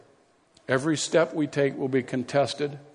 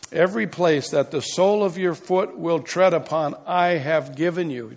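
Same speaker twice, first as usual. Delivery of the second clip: preaching at full force; 3 semitones higher, 3 dB louder than usual. Acted. Reads scripture with force.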